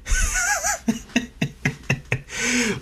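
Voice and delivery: a burst of high, squealing laughter; high cackling laugh. Real, not acted. Host laughing hard at his own mock phone call. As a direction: crack up at the punchline.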